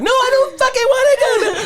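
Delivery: put-on aggressive girlfriend. Teasing a guest by voicing her refusing to calm down, high and shrill. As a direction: play her as a shrill hothead. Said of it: "He's the one telling you".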